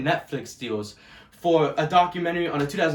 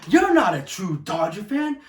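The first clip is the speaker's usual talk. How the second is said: mocking the haters; 11 semitones higher, 4 dB louder than usual. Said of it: "all the fucking time I get".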